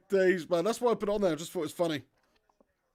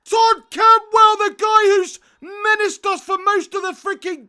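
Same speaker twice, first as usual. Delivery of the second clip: much higher and louder, yelling in disbelief; shocked shout; 11 semitones higher, 15 dB louder, slower than usual. Real, not acted. Fan watching a thirty-yard strike beat his keeper, stunned yell. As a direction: scream it out as the ball flies into the net, slowing on the name. Rival scores a stunning goal.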